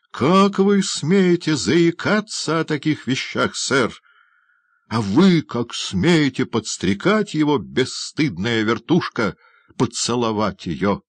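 high, drawn-out outraged rebuke; utter indignation. A narrator's reading. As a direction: scold them both, scandalised and emphatic. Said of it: "воскликнул мистер Бамбл в сильнейшем негодовании". (с сильнейшим негодованием)

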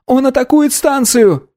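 put-on very high boy's outcry; boy's cry. A narrator's reading. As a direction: cry a warning in a high child's voice. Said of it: "закричал мальчик".

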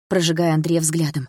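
usual speaking voice

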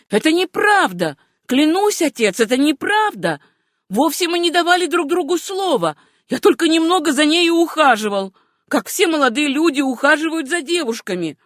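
high, loud frantic denial; agonised cry. A narrator's reading. Sam denies it to his father, high agonised cry. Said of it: "крикнул точно в агонии".

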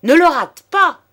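loud, very high call from the crowd; high-pitched bystander's cry; urgent shout. A narrator's reading. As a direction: yell encouragement to the gunman. Second onlooker calls to shooter.

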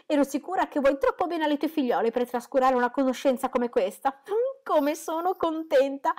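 a mother overflowing with happiness; burst of joy. A narrator's reading. Mrs Bennet gushes with joy at her husband's news. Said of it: "il primo scoppio di gioia".

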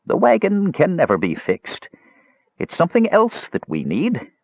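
put-on high-pitched old woman's voice; old crone. A narrator's reading. Old woman telling a traveler what she needs, high crone voice.

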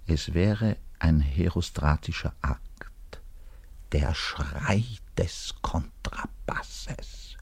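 slow, hushed whisper. Acted. Bass player whispers his plan to scream during the performance.

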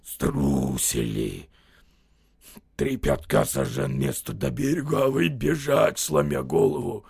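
low, slow, rasping mockery; old soldier jeering at the cowards; hoarse wheeze. A narrator's reading. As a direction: croak it out past the pipe. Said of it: "хрипел".

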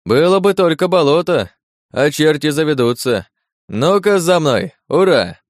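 высокий задорный крик казака; выкрикни повыше, как казак, зовущий за собой; казачий выкрик. A narrator's reading. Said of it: "закричал казак с крючковатым носом". (задорный казачий выкрик, высоким голосом)

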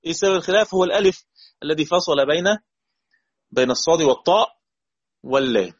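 ordinary talk, nothing marked

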